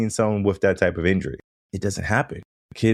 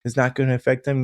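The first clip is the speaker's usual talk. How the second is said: mock-worried parent, pitched up; a lightly voiced fretting parent; hint of an anxious parent pleading; imitating doubtful parents; 6 semitones higher than usual. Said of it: "I understand some parents".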